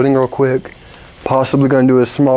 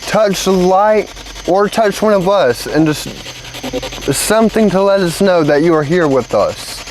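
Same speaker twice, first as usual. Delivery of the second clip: talking to a ghost; 6 semitones higher than usual. Real, not acted. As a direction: gently ask a spirit to answer. S0 calling out to spirits.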